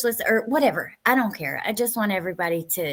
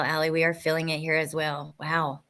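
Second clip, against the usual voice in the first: ordinary delivery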